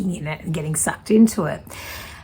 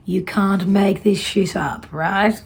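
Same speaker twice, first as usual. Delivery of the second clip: normal talking voice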